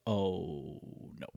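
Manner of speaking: soft, drawn-out dread; a hushed oh no as the bag flies. Retold or imitated. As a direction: let it sink, quiet and slow. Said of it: "Slow motion".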